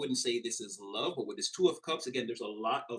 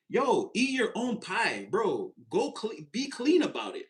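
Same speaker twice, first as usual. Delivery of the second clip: imitating a character from Thinner, slow and raised; drawn-out mock line, higher pitch; Thinner movie quote; 7 semitones higher, 3 dB louder, slower than usual. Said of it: "what did the guy say in Thinner?".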